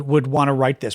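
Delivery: ordinary delivery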